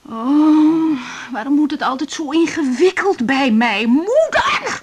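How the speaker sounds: loud exasperated cry, rising to a high call; frustrated outburst. Acted. Woman overwhelmed, loud exasperated outburst. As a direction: vent it, then cry out.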